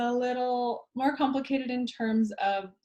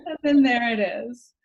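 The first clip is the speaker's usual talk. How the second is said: laughing; 8 dB louder than usual. Real, not acted. Joke punchline.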